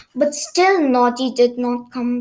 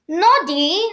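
impatient storybook cry of a name; a touch of exasperation, higher; mild cry; 5 semitones higher, 4 dB louder than usual. A narrator's reading. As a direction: call out the name, raised in pitch. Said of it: "cried Missus Skittle".

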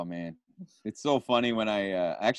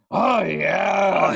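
higher, loud hammy Pacino; Pacino impression; 9 semitones higher, 6 dB louder than usual. Guest answering his friend's impression, a loud Pacino growl. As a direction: ham up the Pacino catchphrase. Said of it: "Pacino that everyone does".

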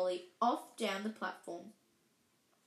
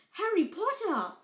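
higher, louder excited outburst; excited chorus; 9 semitones higher, 6 dB louder than usual. Acted. Twins recognising Harry on the train, excited exclamation. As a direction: blurt his name in amazement. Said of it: "chorused the twins".

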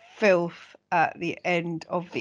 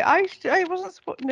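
high squeaky protest; 11 semitones higher than usual. Retold or imitated. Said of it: "they're like".

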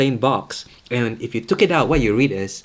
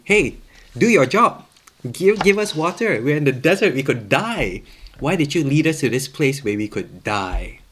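raised, higher voice demanding help; acted complaint; 4 semitones higher, 5 dB louder than usual. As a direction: complain loudly to the boss, easing slightly toward the end. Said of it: "they complain to their boss".

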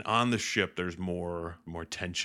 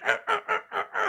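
high, loud little creature yelps; imitated attack cries; 4 dB louder, faster than usual. Yoda beating on R two, frantic high cries. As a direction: yelp with each whack of the stick.

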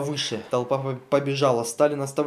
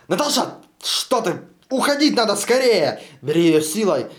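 high, loud hurry; Stalin urging the others to drag Natasha off; urgent call; 6 semitones higher, 5 dB louder than usual. Acted. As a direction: pull her away with your voice.

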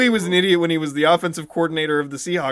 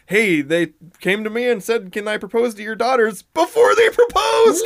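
higher and louder, building to a shout; oblivious coach; 5 semitones higher, 4 dB louder than usual. Retold or imitated. Mimicking the clueless coach telling the press, rising to a shout. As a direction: let it burst out at the end. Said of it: "he told the media".

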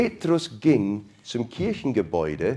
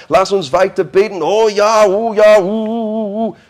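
high, loud, swaying worship voice; ecstatic prayer meeting; 7 semitones higher, 9 dB louder than usual. Pretend. Christians praying for Peter, high ecstatic worship. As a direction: gush and moan in fervent prayer.

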